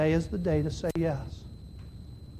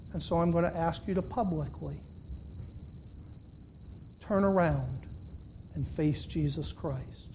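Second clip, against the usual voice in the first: hushed invitation; 2 semitones lower, 10 dB quieter than usual. Real, not acted. Preacher inviting congregation to turn around, hushed. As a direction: lean in and invite quietly.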